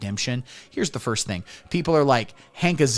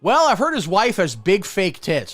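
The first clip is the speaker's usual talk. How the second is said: higher, louder put-on interjection; mock objector; 7 semitones higher, 6 dB louder than usual. Comic voicing someone's claim about his wife, mock objector. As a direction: blurt it out as a loud, pitched-up claim. Heckler's claim voiced in routine.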